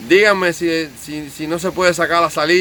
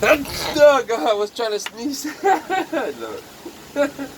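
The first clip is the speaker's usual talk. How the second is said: laughing; 9 semitones higher, much slower than usual. Real, not acted. Laughing after trying to sneeze.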